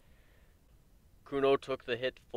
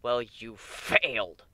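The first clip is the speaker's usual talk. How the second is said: slow, venomous hiss; hissed vehemently; 4 dB louder, much slower than usual. A narrator's reading. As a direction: drag it out, biting and cold. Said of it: "Warren hissed vehemently".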